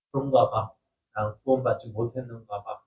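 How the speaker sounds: a believer giving in to the lie; defeated self-doubt. Retold or imitated. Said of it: "생각을 합니다". (낮고 힘없이 체념한 목소리)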